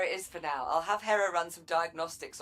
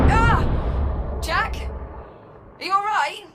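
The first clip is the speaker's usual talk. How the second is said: alarmed shout; 12 semitones higher, 11 dB louder, much slower than usual. Acted. Explosion, worried call to Jack.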